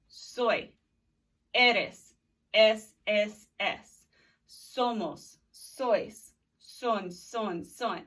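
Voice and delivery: loud, clear chant of each form; conjugation drill. Shown as a demonstration. Teacher drilling the verb ser with students. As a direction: call out the verb forms for the class to repeat. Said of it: "Do it with me".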